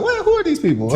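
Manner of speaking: high, puzzled retold question; lightly acted bewildered quote; mock surprise. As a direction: play up the surprise of meeting strangers. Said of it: "I was like".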